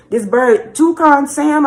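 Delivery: ordinary talk, nothing marked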